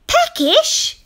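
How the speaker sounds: very high, loud outraged cry; exclaimed. Acted. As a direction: shriek the word in disbelief. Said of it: "exclaimed his father".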